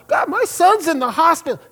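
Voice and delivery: high, pleading objection; pleading protest. Retold or imitated. Pastor retelling his protest to God, pleading. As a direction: argue back in disbelief.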